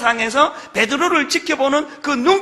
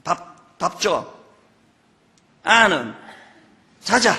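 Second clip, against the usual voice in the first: gruff Gyeongsang husband; 3 semitones lower, much slower than usual. Retold or imitated. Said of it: "딱 세 마디만 한다".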